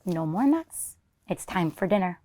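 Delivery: usual speaking voice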